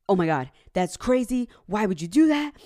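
husband exasperated. Retold or imitated. Husband reacting to the fake price.

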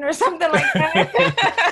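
real laugh through the words, high and loud. Real, not acted. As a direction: break up laughing while talking. Laughs recalling an old store card.

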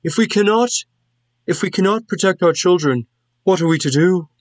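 worried villager arguing in a high voice; distressed townsperson. Acted.

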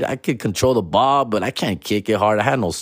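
ordinary delivery

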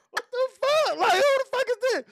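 high, sharp outraged woman's voice; shocked professor. Retold or imitated. Professor spotting her drunk student, high and outraged. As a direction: play the stunned professor, pitch way up. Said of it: "She was like".

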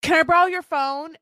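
high, loud mock holler; imitated pleading shout; retold yelling. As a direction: yell it out as if at passing cars. Retelling her yelling at strangers.

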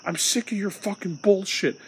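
mocking imitation of an angry mom's shout; imitating mom yelling. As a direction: spit it out like your mom screaming at you. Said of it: "She gets out of her chair and gets in my face and goes".

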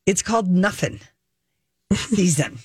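"nothing" said as nuffin, f for th; "nothing" with an f sound, nuffin season. Shown as a demonstration. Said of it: "N U F F I N G".

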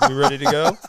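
high, hammed-up rock-star voice; a lead singer rolling in with a cigarette, mocked in a high put-on voice; mock lazy rock star. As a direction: play the careless frontman strolling in late.

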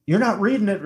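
high-pitched, insistent mock accusation; acted dispute. Co-host re-enacting the tracker argument, insistent.

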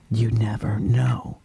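whispery, drawn-out retold aside; slow it down into a knowing whisper; hushed knowing advice. Sister advising her brother, slow hushed knowing tone.

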